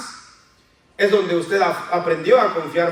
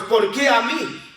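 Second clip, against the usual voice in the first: complaining question; 3 semitones higher than usual. Shown as a demonstration. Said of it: "¿Ve cómo cambia?".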